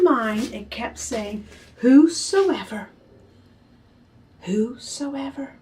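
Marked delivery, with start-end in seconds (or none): 1.8-5.5 very slow, drawn-out repeated word; slow echo. Acted. Echoing word in the girl's mind, slow. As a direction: let it ring like a thought.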